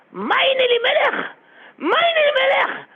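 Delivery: high, slow wailing cry of a name; anguished cry. Acted. Rabbi imagines his own son expelled, wailing cry. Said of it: "je me serais exclamé".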